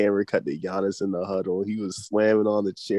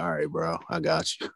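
higher-pitched mock-mellow voice; Chris, calm; 8 semitones higher, 4 dB quieter than usual. Teammate calming a beefing star, imitated as unfazed. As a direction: play it unbothered and agreeable, pitched up. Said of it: "He just be calm".

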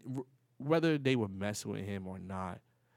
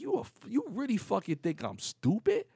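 higher, louder acted outrage; retold offended challenge; indignant retort; 9 semitones higher, 5 dB louder than usual. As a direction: throw it back as an insulted mark. Acted quarrel with the kiosk hustler.

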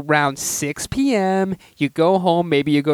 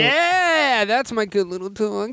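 talking to a puppy; 6 semitones higher than usual. Pretend. Talking to friend like a dog.

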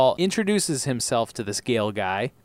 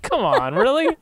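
mock disbelief; 9 semitones higher, 6 dB louder than usual. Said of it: "I'm like".

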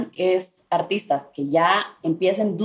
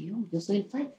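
quiet aside; 11 dB quieter than usual. Retold or imitated. She whispers to herself in story.

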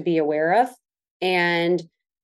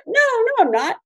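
higher-pitched, playful protest; mock indignant denial. Retelling her reply to Billy's criticism, mock indignant. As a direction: act out a disbelieving denial, pitched up.

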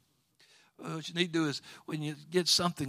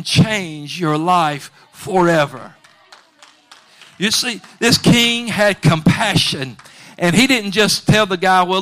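loud, driving preacher's cadence; full-force preaching; 4 semitones higher, 14 dB louder than usual. Acted. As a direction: preach it out to the whole church. Preaching the king's mercy.

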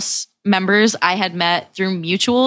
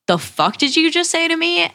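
high-pitched, incredulous retold comeback; indignant challenge; 10 semitones higher than usual. Girl confronting the guy who insulted her, indignant. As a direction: challenge him, pitch jumping up.

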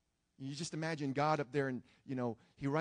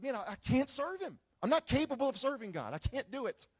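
despairing cry; 8 semitones higher than usual. Acted. Young man's despairing inner voice.

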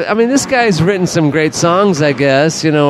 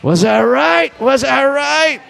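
raised, slow gloating; smug Bob; 9 semitones higher, slower than usual. Retold or imitated. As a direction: crow it high like you called it. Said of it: "And he goes".

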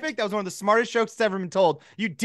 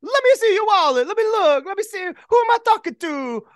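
high, put-on Italian Pope voice; 10 semitones higher, 3 dB louder than usual. The Pope looks through the narrator's wallet in a high put-on voice. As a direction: charm him while pocketing the wallet.

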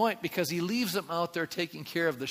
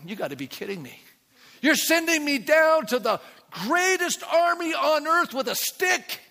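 incredulous Moses; 10 semitones higher, 5 dB louder than usual. Acted. Moses protesting to God.

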